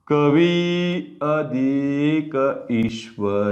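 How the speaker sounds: slow dictation. Shown as a demonstration. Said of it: "आता काय सांगा".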